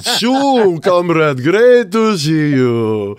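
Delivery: slow mock Russian accent; Yuri Gagarin's ghost. Host joking Gagarin greets Sue, slow mock Russian accent. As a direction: drawl it out like a Russian cosmonaut's ghost. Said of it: "the ghost of Yuri Gagarin appeared and said".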